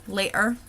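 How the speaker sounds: "later" with a glottal stop for the t. Shown as a demonstration. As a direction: swallow the t in "later". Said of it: "we've got that glottal stop".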